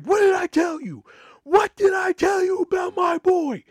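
very high, loud announcer shout; hype shout. Retold or imitated. Host Josh hyping the crowd over the mic, shouting. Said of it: "hear Josh on the microphone like".

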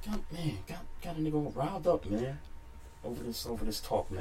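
mumbling low and sulky, barely audible; sulky mutter. Real, not acted. Co-host sulking after losing the argument, muttering softly. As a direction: grumble it under your breath, wounded.

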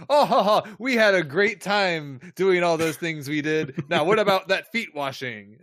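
loud, hammed-up forced merriment; big fake laugh, then boisterous small talk; mock jolly chatter. Hosts mimic characters after a clumsy scene cut, loud mock jollity.

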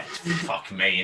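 usual speaking voice

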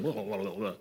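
low, quick run of nonsense chatter; mock babbling. Preacher mimics disciples who just start talking, mock babbling. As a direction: imitate disciples babbling, kept under the voice. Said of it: "So they just start talking".

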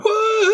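very high falsetto woo cry. Retold or imitated. Blossom waking up wooing, very high falsetto woo. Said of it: "wakes up going".

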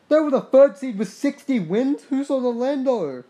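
loud, high disbelieving question; can't believe what he's seeing; shocked gamer reading the standings, loud and high; incredulous. Real, not acted. Disbelief at Orlando's record.

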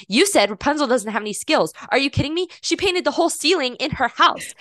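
retold outraged objection; imitating upset kid. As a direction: protest as an offended child would, playfully. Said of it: "was very upset".

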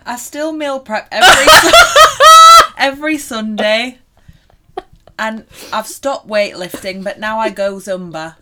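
put-on playful northern English accent; broad Lancashire-style northern accent. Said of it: "Your northern accent is getting well good".

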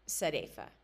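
plain reading voice

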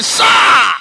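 loud, high desperate call of sir; shouted call. Retold or imitated. Sheep crying out to the man of God, loud high shout. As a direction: cry out to the pastor in need. Pastor acts calling out to shepherd.

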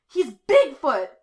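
high, slow, very loud announcement; loud exclamation. A narrator's reading. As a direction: blurt it out for everyone to hear. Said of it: "the teen exclaims loudly".

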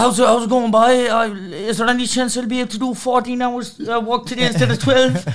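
high, loud, hammed-up Irish labourer; Irish construction worker. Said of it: "Irish construction workers".

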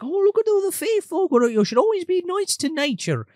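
high-pitched kindly grandpa impression; mock old man advising a child. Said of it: "and he's like".

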